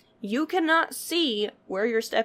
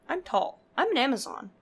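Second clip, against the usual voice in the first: normal talking voice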